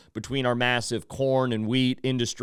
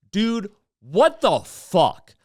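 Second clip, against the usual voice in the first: slow, drawn-out what the fuck; stunned disbelief; 6 semitones higher, 4 dB louder, much slower than usual. Real, not acted. Host reacting to Trump's Pearl Harbor answer, stunned disbelief. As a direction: let the disbelief drag out slowly.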